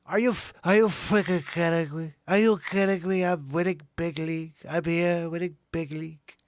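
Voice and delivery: put-on boastful politician voice; loud, indignant candidate impression; mock debate candidate. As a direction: answer the moderator as a caricatured candidate. Acts debater answering the moderator.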